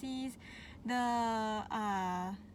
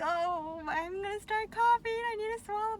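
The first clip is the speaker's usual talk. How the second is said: panicky; 8 semitones higher, 4 dB louder than usual. Retold or imitated. Retold panic in the scanner.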